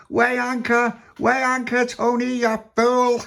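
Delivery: high, urgent shouted command; captain shouting orders. A narrator's reading. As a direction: holler the order across the deck.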